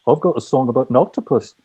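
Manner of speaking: a mild Scouse impression of [name]; [name] Liverpool accent. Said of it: "[name] says".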